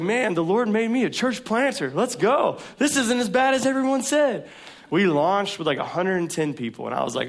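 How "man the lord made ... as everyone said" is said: higher, louder, pumped-up boast; hammed-up swagger of early success; cocky excitement. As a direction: crow like a proud new church planter.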